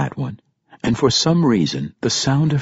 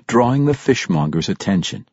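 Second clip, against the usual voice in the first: usual speaking voice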